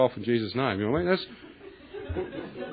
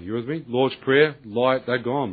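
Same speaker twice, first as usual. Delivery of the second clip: usual speaking voice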